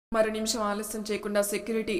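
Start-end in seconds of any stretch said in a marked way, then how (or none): none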